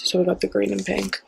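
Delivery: ordinary talk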